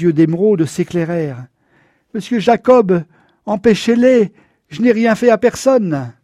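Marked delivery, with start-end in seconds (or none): 2.1-6.1 pleading. A narrator's reading. Old man begging the sheriff.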